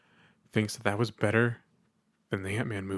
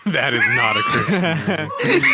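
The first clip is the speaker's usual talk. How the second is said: high, loud, laughing shout; laughing protest; 11 semitones higher, 6 dB louder than usual. Real, not acted. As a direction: shout it in amused disbelief. Laughing protest at Life of Brian suggestion.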